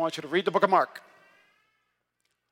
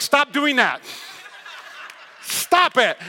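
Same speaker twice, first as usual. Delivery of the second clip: retold alarm, raised voice; alarmed command; 5 semitones higher, slower than usual. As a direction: act out telling someone urgently to stop. Acts out his alarmed order.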